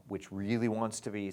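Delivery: normal talking voice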